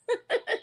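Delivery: brief high chuckle; quick teasing laugh; short laugh. Real, not acted. Laughs after teasing guest.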